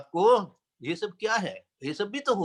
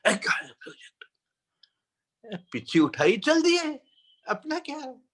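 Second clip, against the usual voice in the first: imitating a teacher's high-pitched dismissal; retold brisk, high rejoinder; acharya's retort; 7 semitones higher than usual. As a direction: quote the guru brushing it off, pitch leaping. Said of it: "हमने पूछ लिया था आचार्य श्री को".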